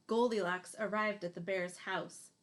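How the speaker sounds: usual speaking voice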